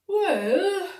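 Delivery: high loud drawn-out well; the dog starting to answer; little dog voice. A narrator's reading. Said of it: "ar sa Bingy".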